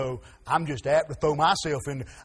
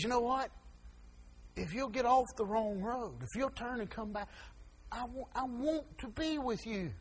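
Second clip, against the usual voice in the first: tender voice of God; 7 semitones higher, 12 dB quieter than usual. Acted. God calling a backslider back, soft and tender. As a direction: coax the wanderer home gently. Preacher voicing God's tender plea.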